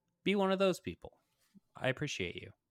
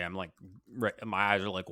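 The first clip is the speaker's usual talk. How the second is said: ordinary talk, nothing marked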